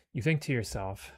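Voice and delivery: usual speaking voice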